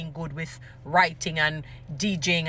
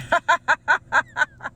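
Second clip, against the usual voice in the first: high, loud burst of laughter; big laugh; 8 semitones higher, 6 dB louder than usual. Real, not acted. Joking about being marketed, hearty high laugh. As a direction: laugh out loud at your own joke.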